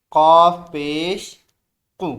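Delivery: slow, loud drilled syllable; teacher's loud slow recitation. Shown as a demonstration. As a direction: call it out loud for the class. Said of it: "ज़ोर से बोलें".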